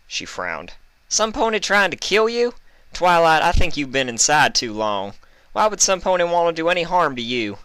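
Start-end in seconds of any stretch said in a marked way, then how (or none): 1.1-7.6 high, loud disbelieving scoff. A narrator's reading. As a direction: brush it off as nonsense. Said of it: "Applejack scoffed".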